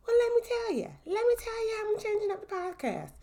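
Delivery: very high, squeaky playful voice; silly put-on voice. Host answering own question about podcast changes, silly high voice. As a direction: pitch it way up like a cartoon character.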